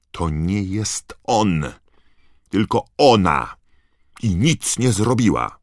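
high, loud protest; shouting. A narrator's reading. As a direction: shout her defence of the elf. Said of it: "krzyknęła Hermiona".

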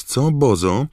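high teasing rise on Bozo; sly gossip voice. Acted. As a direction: needle the photographer playfully. Rita's mocking tag question.

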